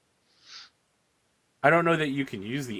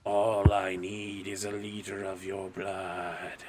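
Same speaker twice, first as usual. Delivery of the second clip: tempting dark voice; 4 semitones lower, slower than usual. Acted. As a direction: lure him in like a sinister spirit. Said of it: "you hear a voice that's like".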